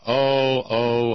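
ordinary delivery

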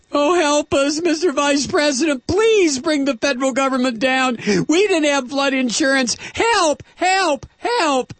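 mock the begging Republicans in a high whine; whiny mock plea. Host mimicking Republicans begging Biden, high whiny plea. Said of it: "What a pathetic collection of whiny asses".

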